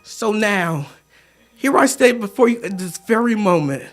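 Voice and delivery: hushed, reverent poem delivery; tender sincerity. Acted. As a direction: let it settle into quiet, heartfelt forgiveness. Poem reaches tender forgiveness.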